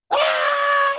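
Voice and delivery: very high, loud yelp of a laugh; surprised laughing shriek. Retold or imitated. Crying client caught off guard, high startled yelp. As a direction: burst out with a startled squeal. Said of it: "And then she goes".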